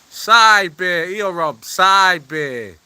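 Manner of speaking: ordinary talk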